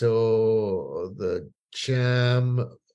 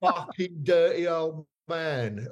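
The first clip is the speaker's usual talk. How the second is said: swearing guitarist; 5 semitones higher than usual. Retold or imitated. [name] swearing on TV.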